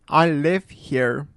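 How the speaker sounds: plain reading voice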